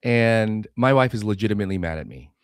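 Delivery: ordinary delivery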